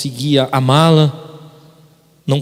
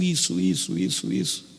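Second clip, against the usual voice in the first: trailing off; 6 semitones lower, 10 dB quieter than usual. Retold or imitated. Listing what mother did.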